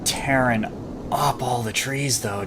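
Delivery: ordinary talk, nothing marked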